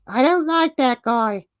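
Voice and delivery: very high, loud put-on child voice; exaggerated high kid voice; mock little boy. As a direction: squeak it out like an eight-year-old. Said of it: "my eight-year-old son started frowning".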